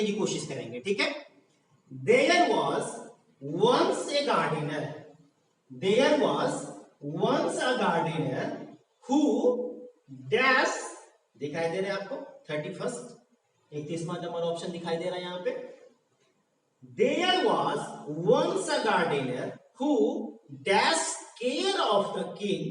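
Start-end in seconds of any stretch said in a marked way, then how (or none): 1.9-10.8 very slow, word-by-word dictation; drawn-out classroom read-aloud for learners. Shown as a demonstration. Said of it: "मैं धीरे धीरे बोल रहा हूं". 16.8-22.6 very slow, drawn-out read-aloud; deliberate classroom dictation pace. Shown as a demonstration. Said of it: "मैं धीरे धीरे बोल रहा हूं".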